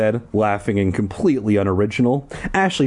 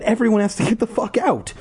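high, urgent yell; urgent cry; 12 semitones higher, faster than usual. Acted. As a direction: shriek out the warning. Ad-lib continuing Ashley's cry.

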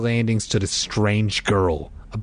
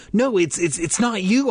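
flustered; 11 semitones higher than usual. A narrator's reading. Flustered denial to brother.

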